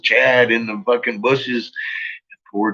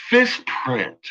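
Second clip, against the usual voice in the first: ordinary delivery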